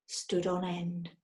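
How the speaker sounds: ordinary delivery, nothing marked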